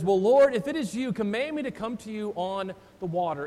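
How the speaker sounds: loud, eager challenge; bold Peter. Retold or imitated. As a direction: call out boldly like impetuous Peter. Peter voiced boldly.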